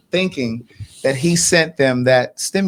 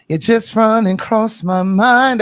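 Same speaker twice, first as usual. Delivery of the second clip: slow, high, drawn-out quote; sing-speak it slow and high; [name] quote; 6 semitones higher, 3 dB louder, slower than usual. Retold or imitated. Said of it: "That was [name] that said that".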